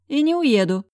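high, emphatic defiant retort; cheeky, acted stubbornness; wry snort. A narrator's reading. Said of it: "хмыкнула подруга".

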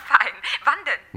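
loud laughing yes; laughing delight. Acted. Simonetta accepting the dinner invitation, laughing delight. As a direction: burst out laughing with joy.

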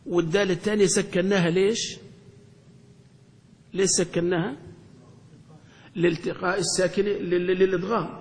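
normal talking voice

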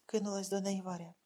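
usual speaking voice

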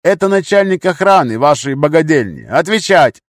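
high, loud command; angry demand. A narrator's reading. Gunman ordering captive to answer, loud angry demand. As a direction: press hard, demanding an answer.

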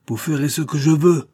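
high, forceful outburst; angry outburst. A narrator's reading. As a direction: burst out as your patience finally breaks. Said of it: "éclatant à la fin".

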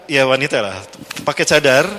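normal talking voice

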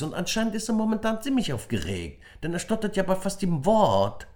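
put-on boy insisting his friend tells the truth; earnest boy. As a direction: stand up for your best friend. Emir voiced defending friend.

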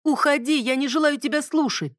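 defiant shout. Acted. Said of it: "Бонни перебила его". (дерзкий крик)

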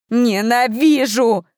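slow, breaking scream of hatred. A narrator's reading. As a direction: let the voice crack with rage. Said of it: "Её срывающийся голос эхом".

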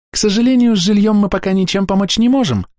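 high, bright chirping voice; chirpy young clerk. A narrator's reading. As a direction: chirp it like a cartoon princess. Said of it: "прощебетала на прощание чистенькая девушка".

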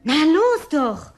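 high, loud, slow coaxing; eager urging. Acted. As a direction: urge him on, excited. Urging Jim to play.

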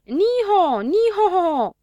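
very high, loud whinny imitation; foal's neigh. A narrator's reading. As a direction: neigh like a clowning foal impersonator. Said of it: "Iar acum voi face ca mânzul". (nechezat de mânz, imitat ascuțit)

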